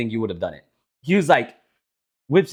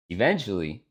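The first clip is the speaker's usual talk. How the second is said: ordinary talk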